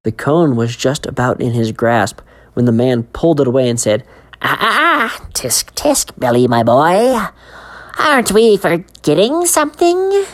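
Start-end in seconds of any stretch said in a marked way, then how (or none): 4.4-10.2 much higher, teasing creepy vendor; sly ice cream man. Acted. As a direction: wag a finger at the boy, sing-song.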